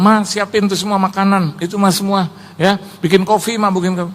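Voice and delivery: calling to wife. Retold or imitated. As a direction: re-enact a husband rattling off requests. Retold calling out orders to wife.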